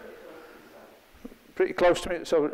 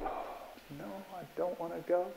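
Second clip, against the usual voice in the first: quiet, dreading "oh no"; a soft reluctant whine; reluctant groan; 11 dB quieter than usual. Retold or imitated. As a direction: sigh it out like you really don't want to go. Imagined dread of an appointment.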